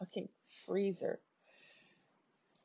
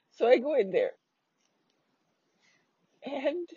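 ordinary talk

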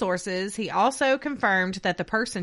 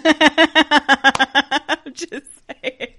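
laughing; 6 semitones higher, 11 dB louder, much slower than usual. Real, not acted. Laughing defensive retort.

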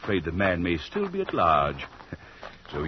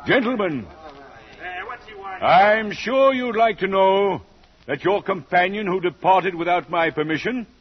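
menacing host; 10 semitones higher than usual. Acted. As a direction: savor the bad news as the genial villain. Host gloats over escaped guest.

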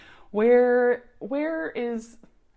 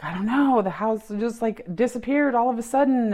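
louder, higher bewildered reply; flustered mom; 5 semitones higher, 6 dB louder than usual. Retold or imitated. Mom explaining the vanished house to dad, bewildered and louder. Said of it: "she's just like".

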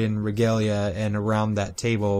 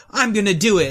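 high, eager mock declaration; suddenly keen, pitched well up; Arthur; 8 semitones higher, faster than usual. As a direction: imitate a hero jumping at the chance. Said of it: "Arthur's like".

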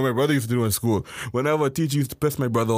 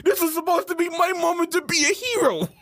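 high, hammed-up wail; whining protest; 14 semitones higher than usual. Kid cheated of his hero moment, whining protest. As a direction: complain like a kid robbed of glory. Acted boy protesting to cops.

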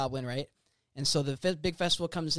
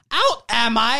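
villain line; 6 semitones higher, 11 dB louder, slower than usual. Acted. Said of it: "Because they said he was out".

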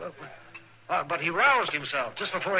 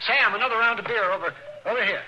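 raised, higher call across the room; 8 semitones higher, 4 dB louder than usual. Acted. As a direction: call the order over the saloon noise. Calling to bartender across saloon.

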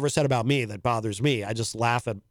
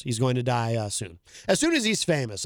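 ordinary talk

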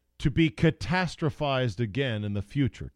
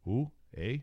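put-on Canadian accent; 2 semitones lower, 5 dB quieter than usual. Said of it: "that Canadian accent".

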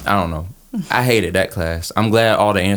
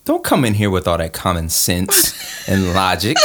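ordinary talk, nothing marked

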